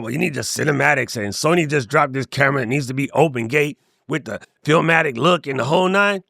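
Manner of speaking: low-pitched mocking know-it-all voice; mock camera geeks. Imitating kids talking about cinematic cameras, mocking low voice. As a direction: drop low to imitate gear-obsessed kids. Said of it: "all these kids talking about".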